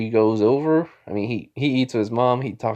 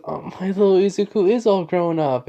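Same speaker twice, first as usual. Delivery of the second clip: high-pitched doting mother voice; gushing mom; 8 semitones higher than usual. Retold or imitated. Mom teases her son about a girl, gushing. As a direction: coo proudly as an acted mom.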